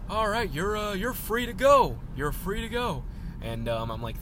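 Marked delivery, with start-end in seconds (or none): 0.1-3.0 high-pitched, drawn-out gate guard impression; hammed-up cartoon guard, voice pitched well up; Hank Hill. Gate guard waves visitor through, Hank Hill impression. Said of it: "all the people at the gates are Hank Hill".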